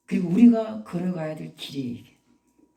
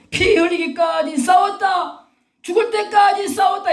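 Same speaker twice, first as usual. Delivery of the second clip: preaching shout; 11 semitones higher, 6 dB louder than usual. Real, not acted. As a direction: belt it out with stretched vowels. Preacher shouting at sermon climax. (목청껏 외치는 설교)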